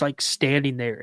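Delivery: ordinary talk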